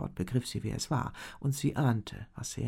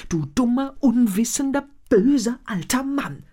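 much higher and louder, screaming abuse; furious shout; 11 semitones higher, 11 dB louder than usual. A narrator's reading. Elena screams at the foolish shaman, furious. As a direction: hurl every insult at him.

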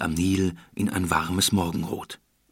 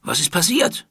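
raised, rising shouted question; calling out; 8 semitones higher, 4 dB louder than usual. Acted. Palace guard calls out to the crowd, alarmed. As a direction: call out sharply across the crowd. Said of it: "rief eine der Wachen".

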